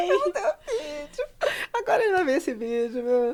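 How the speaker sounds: high, squealing through laughter; laughing mock panic. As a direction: relive the regret, cracking up. Retold panic over sent video, laughing.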